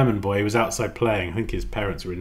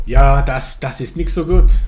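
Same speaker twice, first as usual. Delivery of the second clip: loud, higher attempt at German; German accent; 4 semitones higher, 11 dB louder than usual. Shown as a demonstration. Streamer showing off terrible German, loud German accent. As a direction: put on bad, emphatic German. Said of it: "My German is terrible".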